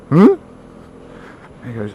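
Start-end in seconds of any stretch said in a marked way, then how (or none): 0.1-0.4 short, puzzled rising grunt; blank incomprehension. Acted. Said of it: "looks at him blankly and goes".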